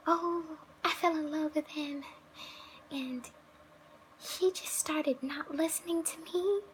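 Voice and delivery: put-on high, whiny lovesick girl voice; mock a girl complaining about her boyfriend; nasal-free high mocking whine. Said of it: "me complaining about".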